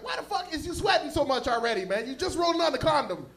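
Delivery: put-on an annoyed girlfriend nagging her man; complaining girlfriend.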